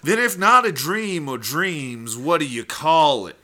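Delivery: loud, hammed-up cross-examination; courtroom prosecutor. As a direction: demand an answer from the witness. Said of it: "[name] is gonna be the prosecutor".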